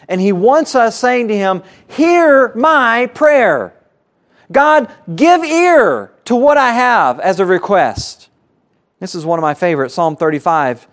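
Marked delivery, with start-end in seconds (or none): 1.9-8.3 bold plea. Retold or imitated. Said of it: "He wants us coming before His throne".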